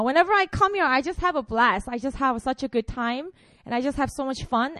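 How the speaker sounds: ordinary delivery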